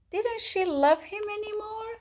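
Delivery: very high, plaintive question; dismayed wondering. A narrator's reading. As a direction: ask it in high-pitched dismay. Narrator voices chick's distress.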